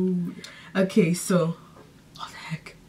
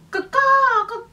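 crow cawing; 3 dB louder, slower than usual. Pretend. Bird impression.

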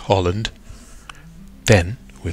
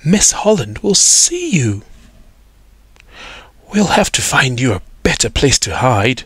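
put-on girl's voice; 6 semitones higher than usual. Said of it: "said Iris".

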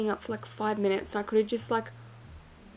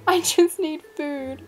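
slow, higher, laughing whine; laughing plea; 6 semitones higher, 5 dB louder, slower than usual. Real, not acted. Starving gamer pleading with the waiter, laughing whine. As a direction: drag it out through a laugh.

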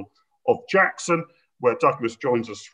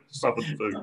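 usual speaking voice

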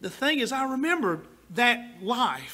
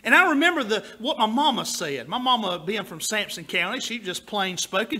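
ordinary talk

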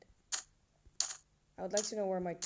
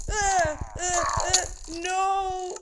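high, loud panicked scream; 11 semitones higher, 12 dB louder, much slower than usual. Real, not acted. Attacked by mobs in game.

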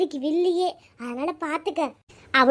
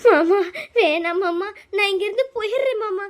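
crying plea; 3 dB louder than usual. Acted. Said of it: "Rita, நீ அழுவாத". (அழுதபடி கெஞ்சும் குரல்)

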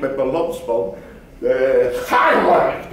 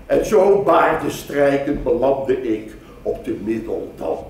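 usual speaking voice

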